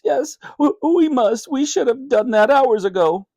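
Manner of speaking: high, urgent frightened voice; a frantic parent calling the police; panicked. Acted.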